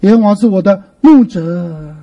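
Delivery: 牧者 drawn out and heavy; stress on 牧者. Shown as a demonstration. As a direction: land weightily on 牧者. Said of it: "重点重重的读它一下".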